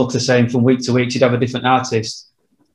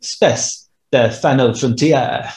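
slow, hammed-up bad French accent on "Space, the final frontier"; mock a screen-test French accent, drawn out; much slower than usual. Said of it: "that's a bad French accent".

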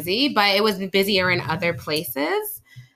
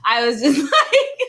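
laughing; 3 semitones higher, 4 dB louder, slower than usual. Real, not acted. Laughing at own story.

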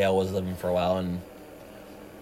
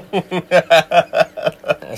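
big cackling laugh; real howl of laughter; loud burst of laughter; 7 semitones higher, 8 dB louder than usual. Real, not acted. Laughs at his own joke.

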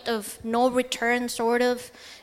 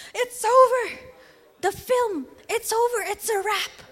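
excited shout; 10 semitones higher than usual. Retold or imitated. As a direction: announce it like a victory. Crew shouting that filming is over.

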